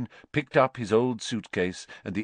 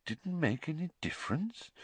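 hushed, questioning echo, lifted in pitch; Dumbledore, quiet; 8 semitones higher, 9 dB quieter than usual. A narrator's reading. Headmaster queries Harry's claim, quietly. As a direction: hand it back softly as a question. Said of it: "said Dumbledore quietly".

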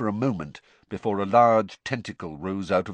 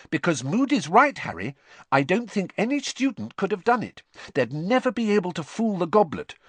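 put-on much higher and louder, eager girl thinking aloud; Hermione, urgent; 10 semitones higher, 5 dB louder than usual. A narrator's reading. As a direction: press on, building excitement. Hermione voiced, rising urgency.

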